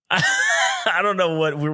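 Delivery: speaking through a laugh, slowed; words pushed out between laughs; talking while really laughing. Real, not acted.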